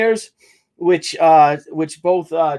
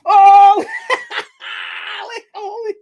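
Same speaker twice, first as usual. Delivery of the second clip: high, loud laughing shout breaking into tears; overwhelmed laugh-cry; 4 dB louder, slower than usual. Real, not acted. Overwhelmed by a gifted flag, laughing and near tears. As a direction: burst out laughing on the verge of crying.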